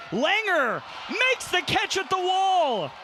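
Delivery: high, loud, drawn out; excited call. Real, not acted. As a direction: shout the great catch. Big catch at the wall.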